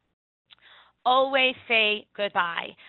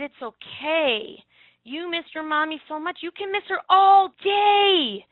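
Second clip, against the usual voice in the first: slow, tender reassurance rising to a higher, louder close; reassuring child; 6 semitones higher, 3 dB louder than usual. Retold or imitated. Teacher comforting a crying girl, slow and tender. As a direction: gently tell a crying girl it's fine to miss mommy. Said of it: "I looked at her and I said".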